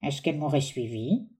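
low, soft aside to himself. Acted. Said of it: "à part".

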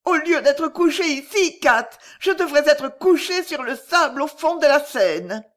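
put-on high, loud despairing girl. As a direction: wail it like a broken young girl. Ruined girl wishes she were dead.

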